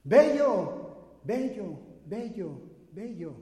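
loud call fading to a whisper; shout then echo. Retold or imitated. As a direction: shout it, then let it die away.

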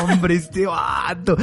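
slow, stretched-out exasperated shout; exasperated outburst. Retold or imitated. Friend exploding at Luis over the egg in a torta, exasperated shout. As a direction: blow up at your friend, dragging the words. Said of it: "Yo ahí exploté".

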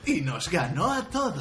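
put-on slow, dubbed blond American boy; American blond kid. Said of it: "se oía la voz de un tío".

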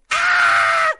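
high piercing mock scream; sudden mock shriek; imitated scream. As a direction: let out a shrill nightmare scream. Mimics Bella screaming.

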